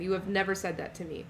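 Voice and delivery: normal talking voice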